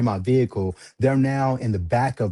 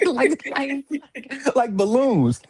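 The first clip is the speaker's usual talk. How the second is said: real high squeal of laughter; 13 semitones higher, slower than usual. Real, not acted. Man telling podcast host about targets flying out, high laughing. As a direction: crack up while describing.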